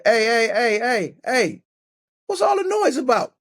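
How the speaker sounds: put-on high, sing-song voice to calm a crying kid; talking to a toddler. Stranger in a grocery store calming a crying kid, high sing-song coaxing. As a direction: coax a little boy out of his tantrum, up high.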